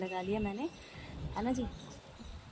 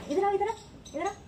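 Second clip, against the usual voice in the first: higher coaxing call; 9 semitones higher, faster than usual. Real, not acted. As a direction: call the little one over, high and sweet. Calling the little niece over. (भतीजी को ऊँची आवाज़ में प्यार से बुलाना)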